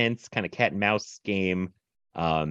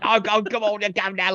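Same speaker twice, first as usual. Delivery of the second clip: high-pitched mock Cockney; hammed-up Cockney chatter; bad Cockney accent; 11 semitones higher than usual. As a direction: imitate an overdone Cockney. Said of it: "Cockney accent".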